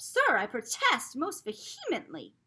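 loud, slow indignant protest. A narrator's reading. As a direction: protest loudly and slowly, outraged. Grandfather confronts the cart man.

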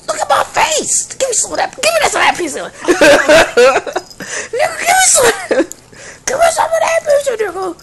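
high, loud, whiny plea for pizza; whiny begging. Acted. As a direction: beg like a starving kid, half-crying.

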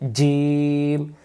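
জিম with a hard j and a long held vowel; firm articulation. Shown as a demonstration. Teacher models the letter from mid-tongue, জিম firm and drawn out. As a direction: press mid-tongue to palate and stretch জিম slowly. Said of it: "শক্ত করে".

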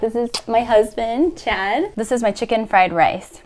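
ordinary talk, nothing marked

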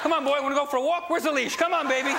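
high, fast excited dog-owner voice; talking to a dog. Pretend. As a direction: tease the dog with a walk. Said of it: "I leave the dog messages".

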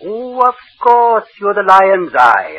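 high, loud and slow gush; high slow excited gush; delighted recognition. Acted. Undertaker suddenly delighted recognizing him.

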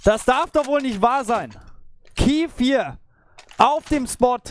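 can't believe the clutch; excited disbelief. Real, not acted. Reacting to the ace.

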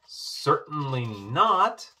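a princess refusing, cross and loud; cross snap. A narrator's reading. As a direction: bite the words off crossly. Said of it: "snapped Poppy crossly".

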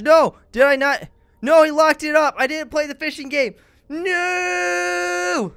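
panicked high yells; scared yelling. Real, not acted. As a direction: yell in real panic at a jump scare. Jump scare in horror game.

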